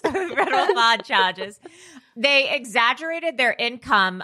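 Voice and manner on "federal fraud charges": put-on laughing Jersey-accented read; hammed-up New Jersey twang. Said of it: "New Jersey accent".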